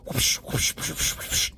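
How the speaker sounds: unvoiced whoosh noises; a run of soft, airy sound effects; imitated whooshing. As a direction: make quick mouth-made swishes. Imitates noise of figures whisking by.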